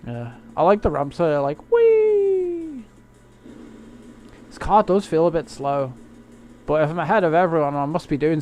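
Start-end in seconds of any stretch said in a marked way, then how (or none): 1.7-2.8 high, loud joyful squeal; gleeful whee. Pretend. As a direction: squeal with delight as the car takes off. Car flying off a ramp.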